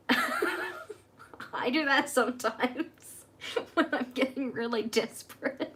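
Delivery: giggling through the words; laughing. Real, not acted. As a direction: laugh while you say it. Laughs while confessing a habit.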